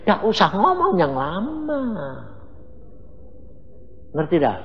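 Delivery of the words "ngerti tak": ordinary delivery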